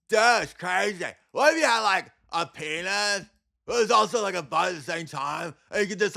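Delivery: mock bear comedy. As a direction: ham up a dim-witted laugh crowd. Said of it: "that's my impression of bear comedy".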